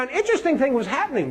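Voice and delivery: ordinary delivery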